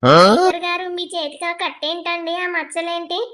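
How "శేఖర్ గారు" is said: sudden loud cry of his name; alarmed call. Acted. Wife startled by his bandaged arm, crying out loud. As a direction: call out to him in shock.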